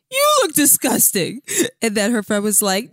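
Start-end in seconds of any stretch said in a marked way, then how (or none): 0.1-1.4 lightly mocked patient, higher pitch; imitated woozy patient. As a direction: toss back a high-pitched mock retort. Imitating patient's retort to friend.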